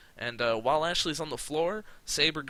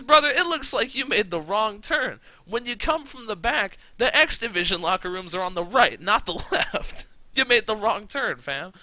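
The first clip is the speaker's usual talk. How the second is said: higher, louder condescending heel voice; mocking heel; 8 semitones higher, 8 dB louder than usual. Champion mocking the newcomer, higher louder condescending taunt. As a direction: talk down to him, laughing at him. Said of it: "he goes".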